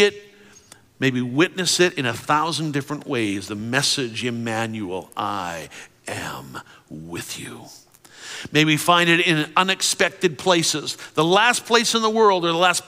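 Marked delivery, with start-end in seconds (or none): 5.2-7.6 slow unvoiced whisper. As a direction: breathe it slowly and softly. Whispered God's message.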